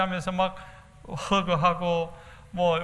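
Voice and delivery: ordinary talk, nothing marked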